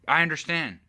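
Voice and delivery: ordinary delivery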